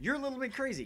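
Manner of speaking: high, loud goofy voice talking to himself; mock high voice teasing himself; silly other self. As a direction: squeak it like a cartoon alter ego.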